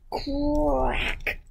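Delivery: high, drawn-out cracking word; cracking egg. Acted. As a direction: make the word sound like an egg breaking.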